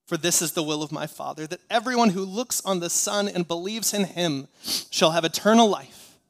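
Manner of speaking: loud, ringing pulpit proclamation; full-force scripture proclamation. Real, not acted. Preacher giving the church the words of Jesus, proclaimed. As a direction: proclaim it loudly to the whole church.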